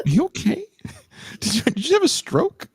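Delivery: very high, incredulous question; squeaky mock alarm through laughter; mock concern. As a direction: check on them, high-pitched and baffled. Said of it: "I was like".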